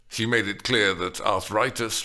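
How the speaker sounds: usual speaking voice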